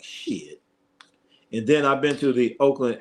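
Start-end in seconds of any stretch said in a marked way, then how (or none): 0.0-0.6 a low, breathless whispered swear; whispered curse. Retold or imitated. Recalling the smoky game, a whispered shocked curse. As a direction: let the curse slip out under your breath. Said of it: "I was like".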